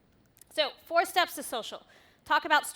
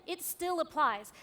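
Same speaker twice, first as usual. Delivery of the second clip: ordinary talk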